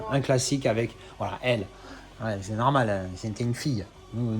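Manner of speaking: usual speaking voice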